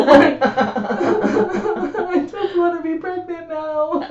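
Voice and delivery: high, slow, laughing through the words. Real, not acted. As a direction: giggle it out.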